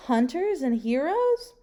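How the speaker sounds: plain reading voice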